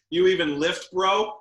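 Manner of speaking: high, loud teasing bro; gym bro. Pretend. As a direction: toss it off like a cocky gym bro.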